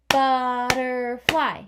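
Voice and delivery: butterfly said slowly and loudly; stretched out. Shown as a demonstration. Teacher sounding out butterfly for the class, slow.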